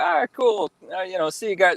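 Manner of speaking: high-pitched friendly sign-off, acted out; cheery goodbye. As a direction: toss a light farewell to fans. Acts his goodbye to fans.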